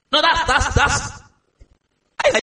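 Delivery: laughing, pitch up. Real, not acted. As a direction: break into a laugh mid-word. Laughs at own slip.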